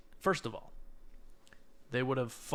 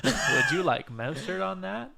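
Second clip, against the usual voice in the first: high, louder playful service-bot voice; burger robot server; 7 semitones higher, 5 dB louder than usual. Pretend. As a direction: offer mustard like a chirpy machine. Playing the burger machine.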